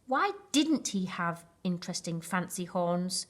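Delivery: ordinary delivery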